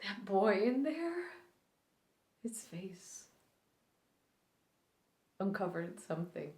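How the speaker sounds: quiet, grieving recluse with long, halting pauses; Allie Mayo. Acted. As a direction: start hushed, let old grief push the pitch up, then sink back. Performs Allie Mayo's grieving monologue.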